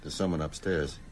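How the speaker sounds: low, soft warning; hushed alarm. Acted. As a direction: drop your voice, someone may hear. Hushed on hearing an intruder.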